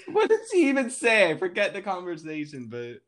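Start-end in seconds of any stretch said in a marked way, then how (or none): 0.0-1.3 words through a real laugh, higher and louder. Real, not acted. Recalling the random beach woman, laughing through it. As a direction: laugh out the question.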